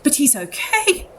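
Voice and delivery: high, anxious mother's voice; horror-struck mother. Acted. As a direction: say it high, grief-stricken, needing reassurance. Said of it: "grief-stricken and horror, horror-struck and goes".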